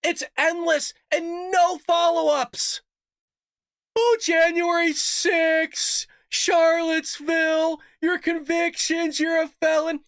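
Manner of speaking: high, slow, hammered-out list; outraged rant. Real, not acted. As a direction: spit each item out in disbelief. Ranting, listing attacks.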